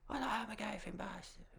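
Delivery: high-pitched cartoon Boston accent; exaggerated Hollywood Bostonian, high and put-on; hammed-up Boston accent. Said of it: "actors slip into their character of".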